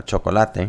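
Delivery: "chocolate" said as Spanish cho-co-LA-te; Spanish pronunciation. Shown as a demonstration. Teacher reading the word list to learners, Spanish pronunciation of "chocolate". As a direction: give "chocolate" four Spanish syllables. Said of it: "for the first word, we have".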